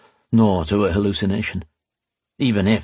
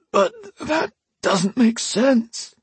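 much higher, slow wheezing voice; a dying prisoner wheezing at a ghost; wheezed; much slower than usual. Acted. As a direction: wheeze it out through pain. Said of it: "Alesh wheezed".

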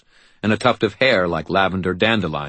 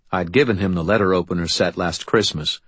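usual speaking voice

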